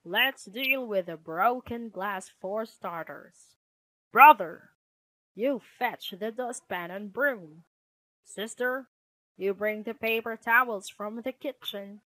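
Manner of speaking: firm, take-charge father; projected, authoritative dad-bear voice; Papa Bear. Acted. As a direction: settle the squabble and give out jobs.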